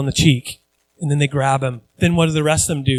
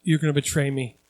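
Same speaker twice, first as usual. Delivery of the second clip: quiet foreboding; 5 semitones lower, 8 dB quieter than usual. Retold or imitated. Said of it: "He goes".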